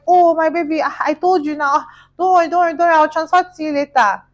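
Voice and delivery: high, loud, hammed-up placating voice; pleading boyfriend. Boyfriend promising a later transfer to his angry girlfriend, hammed-up pleading.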